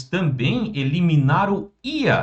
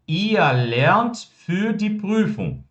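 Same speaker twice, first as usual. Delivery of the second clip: very slow, every German word laid out clearly; slow model sentence; 5 semitones higher, much slower than usual. Shown as a demonstration. Teacher modelling German for students, very slow and clear. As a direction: spell the sentence out slowly for the class. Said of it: "nossa frase afirmativa".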